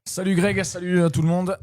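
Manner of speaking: loud, high, bright hello to listeners; excited greeting. Real, not acted. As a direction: greet everyone with a big burst of energy. Cheerful on-air greeting.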